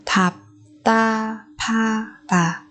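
very slow, drawn-out "Thạp ta pha tà"; syllable by syllable. Shown as a demonstration. Teacher modelling a word for learners, very slowly.

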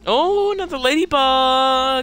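high, slow, loud delighted cry; thrilled to spot another bug; excited discovery. Real, not acted. Gamer spots another ladybug, high excited cry.

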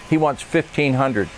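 ordinary talk, nothing marked

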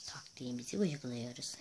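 soft conspiratorial whisper. Real, not acted. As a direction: let the viewers in on a secret. Secretly telling viewers the plan. (fısıltıyla)

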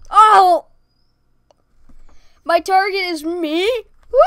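loud, high startled cry; shocked shout. Real, not acted. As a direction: blurt it out in shock. Shocked to be own target.

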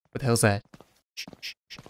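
hushed whisper, shushing; whispering while hiding. Real, not acted. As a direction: keep it down, hiding. Hiding from homeowner.

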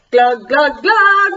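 loud gulping glug, glug; silly glug sounds. Pretend. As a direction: gurgle it out loudly. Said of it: "in the middle of the".

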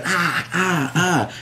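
retold screaming. Retelling his own screams with mild mock pain cries. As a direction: act out yelling after dye hits his eyes. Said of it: "I'm screaming".

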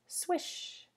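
a breathy high swish like spraying water; spray the word out as a hissing whoosh of water; whooshing hose sound. Acted. Teacher to children acting out the hose, airy swish.

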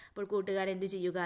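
usual speaking voice

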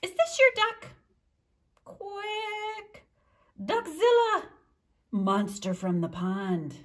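lively read-aloud with high character voices; storytime reading. A narrator's reading. Librarian reading to children, animated character voices. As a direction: read the duck story to the kids, bouncing between voices. Reads picture book with voices.

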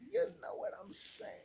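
high, breathless whispered repeat; playful high whisper; whispered silly echo. Acted. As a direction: toss it off again as a squeaky whisper.

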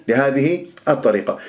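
ordinary talk

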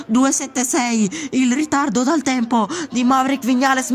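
excited rapid play-by-play; breathless italian goal commentary. Acted. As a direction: call the lap live, breathless. Excited live pole call.

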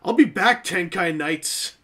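loud, high hammy villain vow; mustache twirling villain. Acted. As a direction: shake a fist at the toys. Vows revenge on the toy line.

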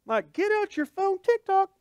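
excited onlooker. Acted. Mock excited bystander.

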